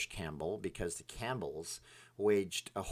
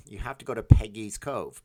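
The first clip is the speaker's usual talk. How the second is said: much louder insistence on the place; emphatic recommendation; 11 dB louder than usual. Real, not acted. Urging a viewer to visit a lighthouse, loud and eager. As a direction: push the tip hard. Enthusiastic travel tip.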